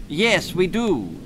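mother firmly overruling her son; sharp. Acted. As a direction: cut off the argument. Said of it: "said his mother sharply".

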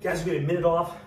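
panting after the set; winded, catching breath. Real, not acted. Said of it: "it's hard for me to catch my breath".

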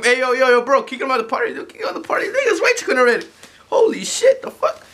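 high, frantic shouting; panicked high-pitched plea; alarmed yelling. Acted. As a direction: yell for someone to kick him out. Panicked demand to kick him out.

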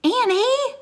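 very high, loud rising cry; alarmed call. A narrator's reading. Boy leaps up to stop the girl, alarmed. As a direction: call her name in protest.

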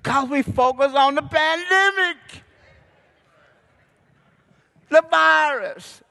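high, loud, stretched preaching; preaching at full force. Real, not acted. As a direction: preach it up to the rafters.

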